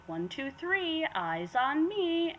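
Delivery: high, slow sing-out for attention; kindergarten teacher. Retold or imitated. Game master corralling noisy players, kindergarten teacher voice. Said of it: "The, the kindergartner method?".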